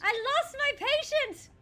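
high, loud panic over a lost patient; the nurse suddenly realizes the patient is missing; panicked cry. Acted. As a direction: burst out in alarm, voice shooting up.

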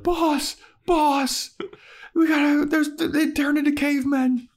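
very high, frantic pleading; panicked squeal. Retold or imitated. Xander running to warn his boss, high panicked squeal.

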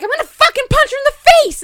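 fast, high-pitched furious yell; screaming threat. Retold or imitated. Retelling her threat to relatives holding her back, screaming. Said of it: "we're, like, screaming at each other".

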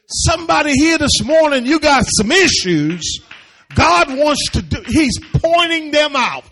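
loud, high-pitched, driving sermon; preaching at full force. Real, not acted. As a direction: preach it out hard to the room.

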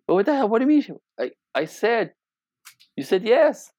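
acted-out husband, high-pitched bewilderment; mock indignant husband caught off guard; baffled protest. Acted quarrel with mad wife.

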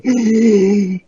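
sharp scandalized gasp; shocked gasp. Retold or imitated. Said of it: "they all looked at me like".

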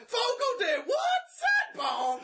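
high, loud incredulous yelp; baffled shout. Acted. Customer yelling back a baffled, high shout. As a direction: shout it like you've never heard the word.

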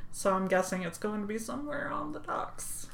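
soft unvoiced whisper. Real, not acted. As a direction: breathe the words out. Trailing off while checking.

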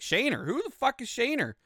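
high mock-incredulous question; confused disbelief. Stranger called by the wrong name, high confused disbelief. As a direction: imitate a baffled man, pitch leaping up.

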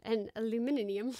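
aluminium said the British way, al-yoo-MIN-ee-um, lightly; a light try at a British aluminium; mock British accent. Host tries the British way of saying aluminium. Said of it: "that's a totally different accent".

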